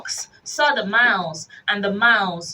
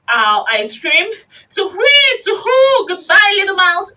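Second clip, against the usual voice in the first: terrified hoot; 10 semitones higher, 8 dB louder than usual. Acted. Terrified owl flees.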